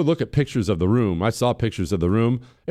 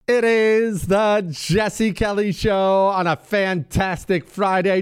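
big, higher-pitched radio show intro; show-opening announcer; 7 semitones higher, slower than usual. Real, not acted. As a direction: draw out the show's opening slowly, with fanfare. Show host's drawn-out opening announcement.

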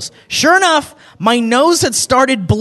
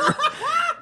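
high burst of laughter; 7 dB quieter than usual. Real, not acted. Reaction to quoted bit.

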